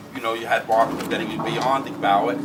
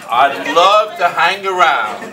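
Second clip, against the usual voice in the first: slow and loud with a laugh; 4 semitones higher, 10 dB louder, much slower than usual. Real, not acted. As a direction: stretch it out, laughing. Jokey reply to commissioner.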